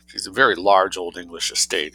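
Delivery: plain reading voice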